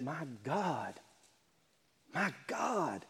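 quiet, broken cry; hushed cry. Acted. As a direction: breathe it out like a dying man. Jesus' cry from the cross.

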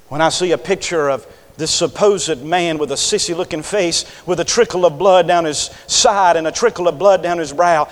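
ordinary talk, nothing marked

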